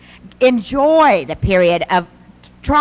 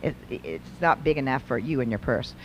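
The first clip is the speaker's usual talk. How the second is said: low, much softer scoffing put-down; dismissive mother; 11 semitones lower, 9 dB quieter than usual. Retold or imitated. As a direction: brush off the teen's excitement. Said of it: "And we go".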